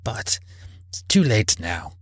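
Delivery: soft, half-whispered regret; hushed and regretful; muttered regret. A narrator's reading. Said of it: "Scrooge muttered".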